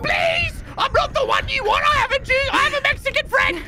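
panicked pleading. Pretend. Begging monster while chased.

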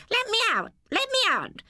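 very high, screechy parrot cry; squawking parrot. Acted. Parrot pleading from its cage, high squawk. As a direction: squawk like a trapped bird. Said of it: "the parrot kept on saying".

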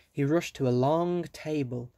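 usual speaking voice

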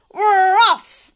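loud, high shouted cheer; crew shout. Acted. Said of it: "we all shouted".

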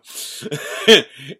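real loud laugh at the punchline; big real laugh; loud burst of laughter. Real, not acted. Punchline, Chris arrives.

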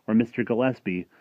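plain reading voice

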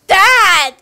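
loud, high cry for Dad; acted shout. Child plays Nemo answering his dad, loud shout. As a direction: shout it as a little fish calling his father. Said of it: "He says".

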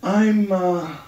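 giggly, high-pitched stoner voice; stoned marijuana voice. Acted. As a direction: laugh through it, baked. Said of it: "Oh, yeah, I'm marijuana".

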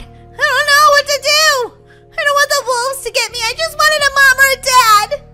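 high, distraught pretend outburst; panicked wail. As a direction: wail like a desperate child, hammed up. Panicking orphan wails.

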